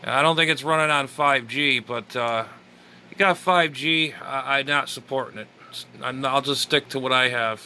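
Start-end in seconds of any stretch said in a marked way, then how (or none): none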